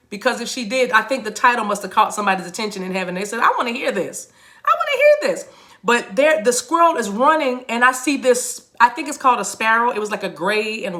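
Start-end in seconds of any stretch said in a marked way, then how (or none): 4.7-5.5 very high imitated voice; high-pitched eager listener. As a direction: squeal it as an eager eavesdropper from above. Heaven's listeners quoted, repeated higher.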